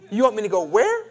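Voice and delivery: "where" leaping up at the end, loud; inflection on WHERE. Shown as a demonstration. Preacher demonstrating the inflection to the congregation, incredulous. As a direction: throw a disbelieving rise onto "where". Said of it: "hit that inflection on the back".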